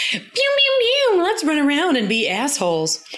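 high-pitched playful pew-pew goofing; playing a boy running wild with a toy gun; mock Fergus. Host mocking Fergus playing with the gun, silly high voice. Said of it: "Fergus is like".

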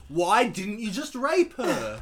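loud, slow Scottish Connery drawl; hammed-up Sean Connery accent; Sean Connery impression. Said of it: "that impression I did".

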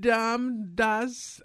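high-pitched attempt at German; badly faked German accent. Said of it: "hard to do a German accent".

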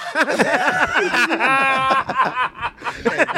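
long high laughing fit. Real, not acted. Laughing at own accent slip.

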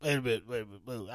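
ordinary delivery

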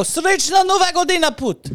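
high, loud foreign-language cheer; mock festive shout; celebratory call. Calling out a Russian greeting.

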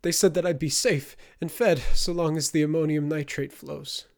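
whimpering. A narrator's reading. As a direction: plead like a frightened man. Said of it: "Marcus whimpered".

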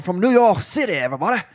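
a hint of a Louisiana accent, mocking the actor; Tom Hardy's Venom Louisiana accent. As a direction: lightly imitate Hardy playing Venom with a Louisiana twang. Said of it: "like a Louisiana accent all the time".

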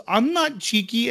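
put-on high indignant little kid voice; kid voice protesting. Bingo protesting to her mom, high indignant kid voice. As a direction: squeak out a puzzled what as the child. Bingo denies being cheeky.

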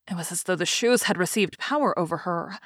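ordinary delivery, nothing marked

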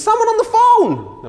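very high, loud hammed-up American yell; American accent shout. Wife yells to Spitzer in a high American shout. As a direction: shriek it like a caricatured American wife. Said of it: "I'm not gonna do any more American accents".

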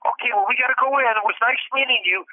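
a hurried, chirpy goodbye; imitating her. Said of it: "she goes".

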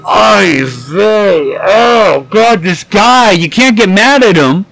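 telling friends about a clueless coworker, a hammed-up exasperated moan; exasperated groan. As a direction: moan it out like he can't believe the guy. Retold disbelief at coworker.

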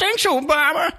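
squeaky falsetto meme line; high mock voice. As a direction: toss it off in a silly high falsetto.

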